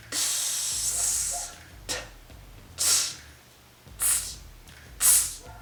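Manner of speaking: "tss" with the T held and released into a hiss; T into S drill. Shown as a demonstration. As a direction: repeat "tss", letting the T open into an S. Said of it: "You can do it by, um, saying".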